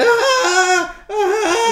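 loud high-pitched wailing shriek; child's shriek. Acted. Player as the child starting to shriek, loud shrill wailing. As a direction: scream like a terrified child, very high.

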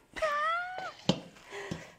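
very high squealing laugh; delighted laugh. Real, not acted. Discovering the tin is full, high laughing squeal. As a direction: let out a high burst of laughter.

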